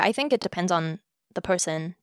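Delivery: ordinary talk, nothing marked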